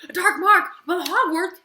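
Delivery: as if you can't believe it; shocked disbelief. Retold or imitated. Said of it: "And I was like".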